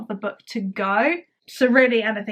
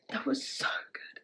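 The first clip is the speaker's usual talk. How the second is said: soft awed whisper after a sigh; breathless whisper; 2 semitones higher, 14 dB quieter than usual. Real, not acted. Reader whispering after finishing the book, awed. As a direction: breathe it out in awe.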